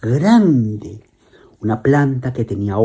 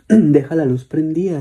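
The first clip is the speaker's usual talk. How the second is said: louder, higher urgent begging on "deja la luz prendida"; urgent plea; 7 semitones higher, 5 dB louder than usual. Acted. Girl insisting to father, urgent loud plea. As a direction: push the plea hard.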